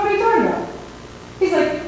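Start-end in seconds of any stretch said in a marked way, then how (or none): 0.0-0.7 retold disbelief, pitch jumping up; incredulous. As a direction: question it in baffled disbelief. Retold argument with superior pastor.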